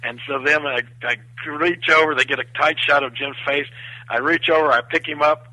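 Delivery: ordinary delivery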